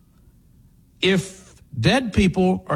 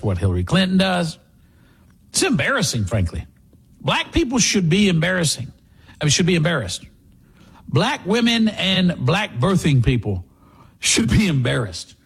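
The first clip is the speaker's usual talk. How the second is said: ordinary talk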